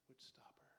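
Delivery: whisper. Real, not acted. Sermon climax dropped low.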